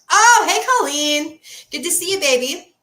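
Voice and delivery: high, loud cheerful hello; loud greeting. Real, not acted. Greeting someone entering.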